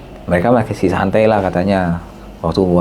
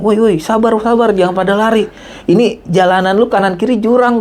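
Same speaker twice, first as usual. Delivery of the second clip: raised high call across the slope; calling out to calm; 12 semitones higher than usual. Retold or imitated. Calling after the running hikers to calm down, high raised voice. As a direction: call out to stop them running.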